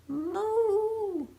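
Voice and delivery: high, playful moo; cow moo. Acted. Reading a baby book aloud, playful high moo. Baby says animal sounds.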